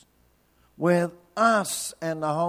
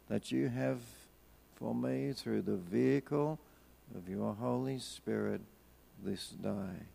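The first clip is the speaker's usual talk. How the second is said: soft, hushed, unhurried prayer; quiet prayer; 6 semitones lower, 9 dB quieter than usual. Shown as a demonstration. Preacher leading the congregation in prayer, soft and hushed. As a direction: pray it low and gently.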